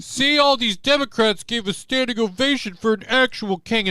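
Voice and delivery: high-pitched mocking imitation; hammed-up whiny outrage; mock indignant complainer. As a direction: squeal it like a silly objector. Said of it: "We're like".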